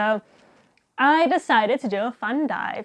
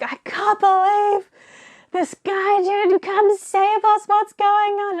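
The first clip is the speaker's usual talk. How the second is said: imitating panicky mum; 10 semitones higher, 4 dB louder, slower than usual. Mum panicking after the boat passes.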